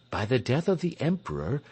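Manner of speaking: ordinary delivery, nothing marked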